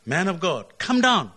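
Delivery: loud, high shouted summons; commanding shout. Acted. A captain shouting up at the prophet, loud and high. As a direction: bark the order up the hill.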